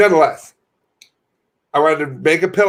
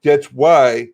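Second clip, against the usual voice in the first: louder mocking preacher-ish voice; 2 semitones higher, 4 dB louder than usual. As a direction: lay on a smug pious tone. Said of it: "they go".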